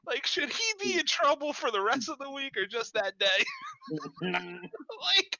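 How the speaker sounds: squeaky giggling through words; barely holding in laughter; laughing. Real, not acted. Teasing question, cracking up.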